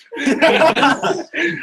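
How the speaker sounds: a high, loud burst of laughter; real laugh. Real, not acted. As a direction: laugh out loud at the silly idea. Laughing at the roleplay idea.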